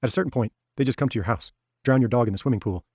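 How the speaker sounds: plain reading voice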